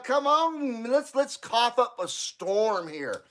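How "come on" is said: usual speaking voice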